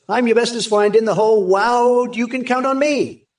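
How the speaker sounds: put-on high doting baby talk; sweet nothings.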